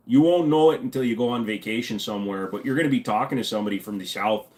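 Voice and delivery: normal talking voice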